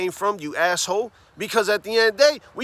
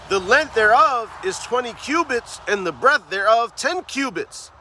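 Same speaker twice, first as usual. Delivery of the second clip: scripture reading; 2 semitones higher than usual. Acted. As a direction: read the verses out like a preacher at the pulpit. Reads KJV scripture aloud.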